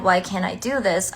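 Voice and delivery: ordinary talk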